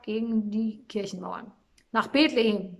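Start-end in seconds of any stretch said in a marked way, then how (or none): 1.9-2.8 loud marching cry; rallying call. A narrator's reading. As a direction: call the group onward. Shepherd gives the marching call. (lauter Aufruf zum Aufbruch)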